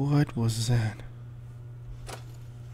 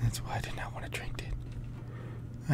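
much softer, breath-only muttering; whisper; 2 semitones lower, 14 dB quieter than usual. Real, not acted. Player grumbles to self about drink, whispering. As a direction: whisper it as an aside.

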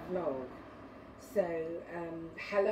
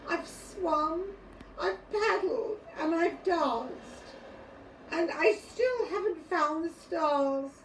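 put-on high, louder plaint of an upset little boy; tearful pleading; 9 semitones higher, 4 dB louder, slower than usual. A narrator's reading. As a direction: act out a child close to tears. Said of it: "His eyes welled up with tears".

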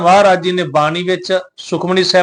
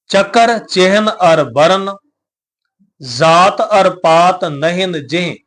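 slow measured reading of the verse; slow recitation; much slower than usual. Shown as a demonstration. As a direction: recite the verse slowly for learners, then repeat it. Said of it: "Maharaj ਜੀ ਉਚਾਰਦੇ ਨੇ".

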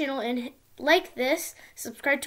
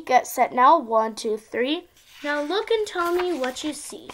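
ordinary delivery, nothing marked

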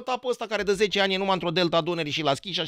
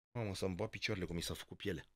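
hushed awe; 9 semitones lower, 15 dB quieter than usual. Retold or imitated. Son's awed reaction quoted.

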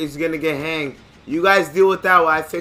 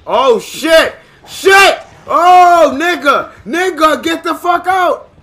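startled high-pitched yell; panicked shouting; 14 semitones higher, 12 dB louder, much slower than usual. Real, not acted. Player yelling at an attacker in a game, panicked shouting. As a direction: yell in alarm at a sudden attack.

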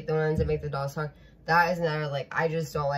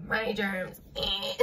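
higher mock "germs" voice; movie character impression; 5 semitones higher, slower than usual. Reactor mimicking a scene from Scary Movie two, put-on voice. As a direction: imitate a squeamish little movie character. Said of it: "Reminds me of Scary Movie two".

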